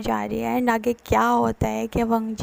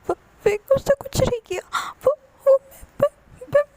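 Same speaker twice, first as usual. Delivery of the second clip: much higher, loud broken sobbing stammer; crying stammer; 4 dB louder than usual. Retold or imitated. Scared partner sobbing on the phone, stammering. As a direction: choke on the words through tears.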